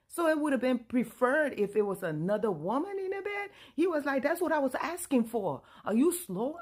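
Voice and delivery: loud, high back-and-forth; heated argument. Retold or imitated. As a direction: argue in full outrage. Couple shouting at each other.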